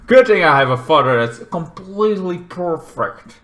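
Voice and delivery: normal talking voice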